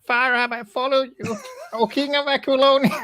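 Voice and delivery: very high squeaky voice, laughing. Shown as a demonstration. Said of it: "like a high voice".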